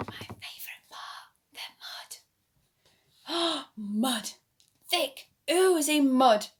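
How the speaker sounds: fast secret whisper; excited whisper. A narrator's reading. Storyteller to children, an excited whisper. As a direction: share it like a secret.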